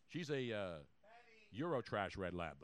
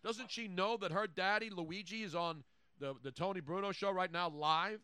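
mock indignant; 6 semitones higher than usual. Mock outrage at the dog.

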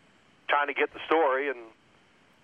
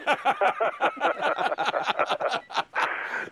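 wheezy high-pitched laugh; high breathy laugh; 12 semitones higher than usual. Real, not acted. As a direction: cracks up in a near-silent wheeze. Cracks up at own story.

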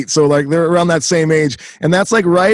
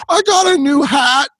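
put-on higher, slow little-kid voice; 10 semitones higher than usual. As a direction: play the proud kid showing off his hat. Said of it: "And I'll just be like".

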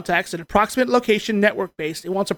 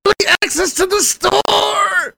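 high, drawn-out mock-indignant yell; outraged shout; 11 semitones higher, slower than usual. Podcaster ranting about early access to the store, outraged shout.

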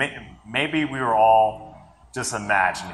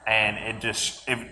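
usual speaking voice

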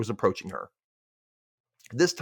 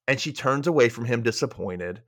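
ordinary talk

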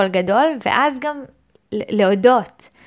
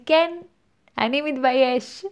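playfully owning it, a bit higher; proud declaration; 5 semitones higher than usual. Pretend. Host modelling how to own your shame, proudly. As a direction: declare it with pride, breaking into a laugh. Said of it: "ופשוט להחזיק בה בגאון, להגיד".

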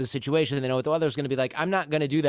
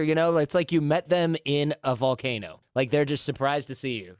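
usual speaking voice

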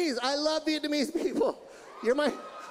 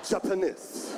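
low mock Japanese male accent; hammed-up deep Japanese accent; Japanese man; 8 semitones lower, slower than usual. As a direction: drop it into the diaphragm, Japanese-accented. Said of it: "it come from diaphragm".

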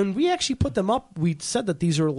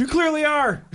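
high mock voice; 10 semitones higher than usual. Mock reply in the quote game.

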